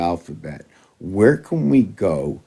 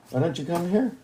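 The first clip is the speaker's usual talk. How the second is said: higher, softer pleading call to the cat; coaxing a pet; 7 semitones higher, 6 dB quieter than usual. Real, not acted. Urging the cat to come to him, high and soft. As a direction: sweetly beg the cat to come.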